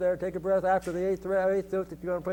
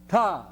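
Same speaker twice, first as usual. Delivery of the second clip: one TA punched out louder; accented TA; 5 dB louder than usual. Shown as a demonstration. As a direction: hit a single TA as a strong accent. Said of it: "And then the accents".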